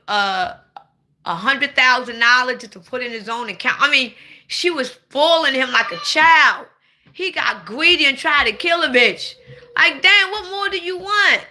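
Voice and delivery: ordinary delivery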